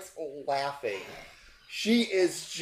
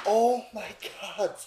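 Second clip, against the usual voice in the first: higher, rushing relief; relieved gush; 6 semitones higher than usual. Acted. GM voicing Hope greeting the survivors. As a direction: gush it like you're thrilled they lived. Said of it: "and she's like".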